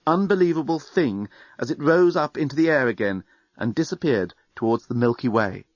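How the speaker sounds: ordinary delivery, nothing marked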